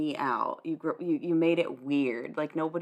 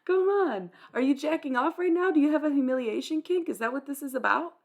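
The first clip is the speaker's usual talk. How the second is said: incredulous mocking; 8 semitones higher than usual. Real, not acted. Mocking chatter's motives.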